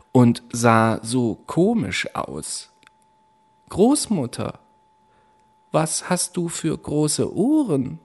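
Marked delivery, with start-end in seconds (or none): 3.7-8.0 put-on girl staring at the wolf in bed; wondering girl. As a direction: ask it wide-eyed and uneasy. Rotkäppchen wonders at grandmother.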